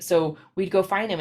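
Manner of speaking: usual speaking voice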